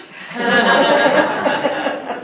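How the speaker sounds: a high, loud laugh bursts out; bursting into high laughter. Real, not acted. Laughs at Doc Martin.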